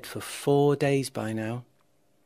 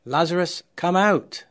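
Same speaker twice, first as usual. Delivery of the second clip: raised, commanding shout toward the cave; 4 semitones higher, 6 dB louder than usual. A narrator's reading. Jesus summons dead Lazarus from the cave, shouting. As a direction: call him out firmly and loudly. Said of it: "Jesus shouted again".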